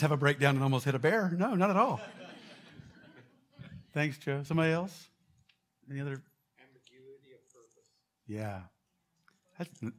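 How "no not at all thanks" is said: usual speaking voice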